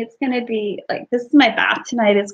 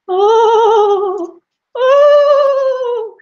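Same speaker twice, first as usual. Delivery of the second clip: high, hollow ghostly wail; ghost moan; 11 semitones higher, 7 dB louder than usual. Acted. Ghost sound in hotel story.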